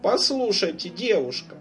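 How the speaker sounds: insistent lady customer. Acted.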